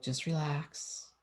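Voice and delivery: slow, soft calming words, retold; quiet, slow aside to self; self-soothing. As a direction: tell yourself softly to settle, drawn out. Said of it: "and then I was like".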